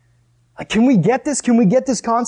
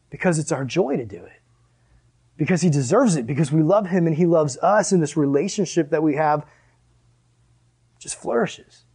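normal talking voice

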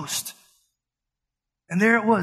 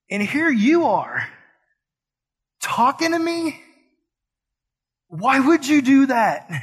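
high, rising disbelief at being spoken to; incredulous; 4 semitones higher than usual. Retold or imitated. Woman at the well asking Jesus, incredulous. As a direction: ask it like you can't believe he'd talk to you.

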